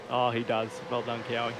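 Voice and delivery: ordinary talk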